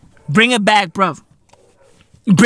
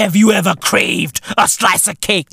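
normal talking voice

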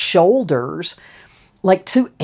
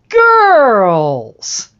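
put-on big booming welcome shout of girls; a delighted host shouting his greeting; loud greeting; 6 semitones higher, 7 dB louder than usual. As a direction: call out to them, arms wide open. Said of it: "And he says".

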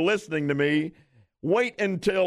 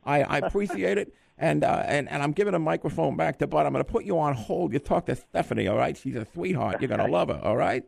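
Mike Tyson voice. Acted. Host as the boxer greeting the winning caller, little teeny voice. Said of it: "finally recognized my voice".

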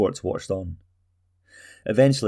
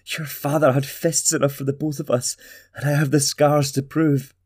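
higher, pained mother's voice; hurt mother; 6 semitones higher than usual. Acted. Talgo's mother tells her son of her scars, higher and pained. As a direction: plead with the memory of the scars.

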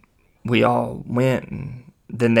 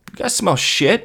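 high, loud, startled asking; suspicious question; 8 semitones higher, 5 dB louder than usual. Retold or imitated. Driver suddenly smelling something in the car, high and loud. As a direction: imitate a driver blurting out a suspicion.

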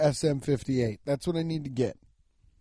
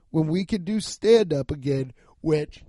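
ordinary talk

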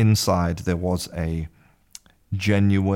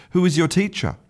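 eager; 11 semitones higher, 3 dB louder, faster than usual. Acted. Irishman eager to compare teachers.

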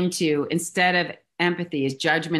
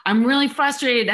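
higher and louder, venting customer; mock frustrated client, raised voice; 8 semitones higher, 3 dB louder than usual. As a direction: play the upset client complaining. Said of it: "they're screaming at you".

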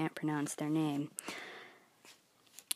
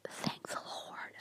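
whispered and much softer, slow; whispered relief at the cheap Goodwill price; whispered aside; 12 dB quieter, slower than usual. Real, not acted. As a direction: breathe it out as a private thanks.

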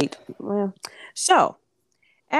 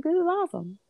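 excited; 11 semitones higher than usual. Retold or imitated. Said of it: "I was just sitting there like".

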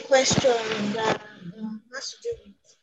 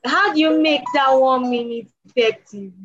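louder, higher, amused question; laughing question; 4 semitones higher, 4 dB louder than usual. Real, not acted. Asking the teacher how one minute works, laughing. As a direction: ask it through real laughter. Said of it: "why I'm even laughing".

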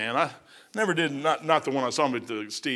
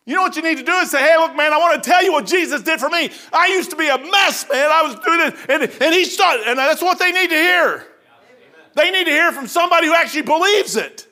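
higher, louder preaching push; 9 semitones higher, 5 dB louder than usual. Acted. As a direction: drive the point home from the pulpit. Preaching, raised voice.

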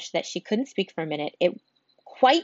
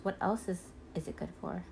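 dismissive flower; 9 dB quieter than usual. Acted. Rose dismissive.

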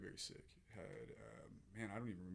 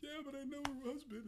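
a much higher, tight mock whimper; squeaky high reply. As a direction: answer through tears in a squeaked-up voice. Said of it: "I'm like".

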